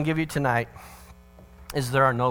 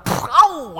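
loud, high "oh" of pain; mock pained yelp; 11 semitones higher, 8 dB louder than usual. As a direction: cry out as if squirted in the eye. Acts getting hit in eye.

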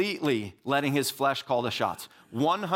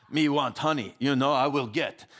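put-on caveman Russian accent; 2 semitones lower than usual. Sermon joke about Samson, caveman Russian accent. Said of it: "I don't know why I was Russian".

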